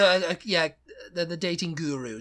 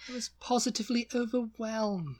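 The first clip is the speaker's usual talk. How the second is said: higher, theatrical posh British; posh British impression; 6 semitones higher, 3 dB quieter than usual. Joking about Tom's accent, hammed-up posh British. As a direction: ham up an over-the-top posh British line. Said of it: "like a female Angela Lansbury".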